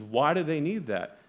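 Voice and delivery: ordinary delivery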